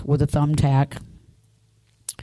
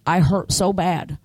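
usual speaking voice

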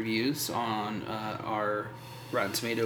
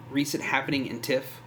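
ordinary delivery

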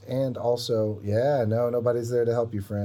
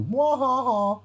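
high villain laugh; evil cackle; 5 dB louder, slower than usual. Pretend. Gloating at the character.

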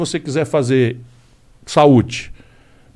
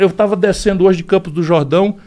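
ordinary delivery

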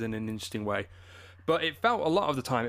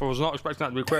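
talking round a mouthful of ice; words muffled by ice cubes; much faster than usual. Real, not acted. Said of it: "I've got ice in my mouth".

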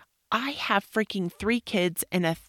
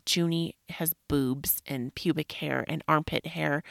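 normal talking voice

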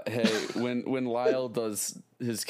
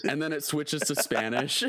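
ordinary talk

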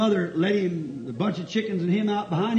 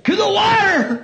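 shouted high preaching; 12 semitones higher, 5 dB louder than usual. Acted. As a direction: preach it out, loud and slow. Preaching at full force.

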